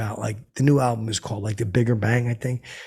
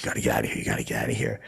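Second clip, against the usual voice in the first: much lower, firm voice; stern low order; 13 semitones lower, much faster than usual. Retold or imitated. Deirdre telling the drunk comic to leave, lower and stern. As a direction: lay down the law bluntly.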